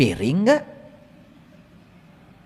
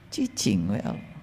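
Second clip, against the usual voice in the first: soft, lower mock hushing; hushed; 12 semitones lower, 12 dB quieter than usual. Worshipper telling God to stay quiet, hushed mock. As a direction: shush God as if he's a nuisance.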